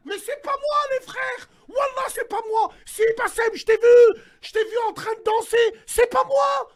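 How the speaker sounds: high, loud frantic protest; mock panic, shouting it wasn't him; panicked denial. Denying deepfake, caught dancing.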